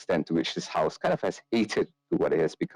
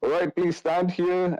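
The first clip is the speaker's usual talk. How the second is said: much higher-pitched priest voice; priest character; 12 semitones higher than usual. Acted. Priest asks his helper for holy water, high put-on voice. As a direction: play a priest in a raised, put-on voice.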